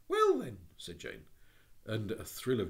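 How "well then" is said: put-on high, bright child's voice; a high little-girl voice. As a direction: call out like an eager little girl.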